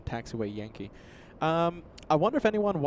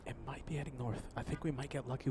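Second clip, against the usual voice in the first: soft hushed repeat; quiet; 10 dB quieter, faster than usual. Real, not acted. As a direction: murmur it to yourself. Repeating a hopeful guess.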